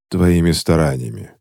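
ordinary delivery, nothing marked

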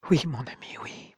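whispered, broken by grief; a widower confirming his wife's death in a whisper; holding back a sob. A narrator's reading. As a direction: barely get the words out through tears. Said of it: "ne put réprimer un sanglot".

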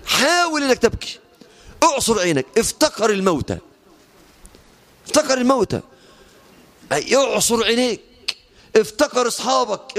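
high, impassioned sermon exhortation; preaching at full force. Acted. Preacher pleading with the faithful to train for tears, impassioned. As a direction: urge them to cry with rising fervour.

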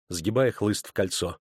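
ordinary delivery

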